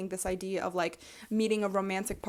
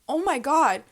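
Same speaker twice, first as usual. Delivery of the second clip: higher, louder, gushing offer; excited giving; 9 semitones higher, 7 dB louder than usual. Acted. Offering a neighbor all my eggs, gushing. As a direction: eagerly hand over everything.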